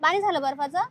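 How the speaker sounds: ordinary talk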